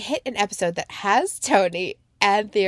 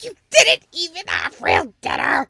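higher, louder indignant jab; mock indignant retort; 7 semitones higher, 4 dB louder than usual. Viewer retorting at the parents, indignant. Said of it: "And I'm like".

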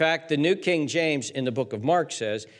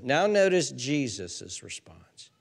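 usual speaking voice

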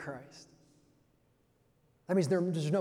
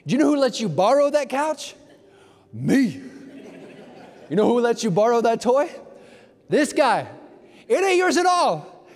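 much higher, louder, half-laughing father; mock-stern gloating; 7 semitones higher, 8 dB louder than usual. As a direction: gloat over the kids, hammed-up. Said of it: "I said".

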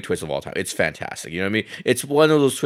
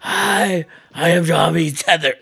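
much higher, louder strained tethered voice; 10 semitones higher, 5 dB louder, slower than usual. Pretend. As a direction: croak it out like the creepy double from Us. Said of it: "I've invited my doppelganger, my tether".

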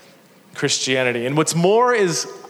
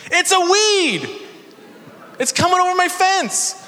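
high, exasperated mock protest; it's a weed, pitched way up in exasperation; incredulous retort; 13 semitones higher than usual. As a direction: squeak it out in disbelief. Speaker retorts to neighbor.

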